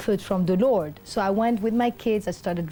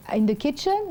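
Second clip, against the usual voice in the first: light surprise; 8 semitones higher than usual. Retold or imitated. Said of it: "her fake casual questioning".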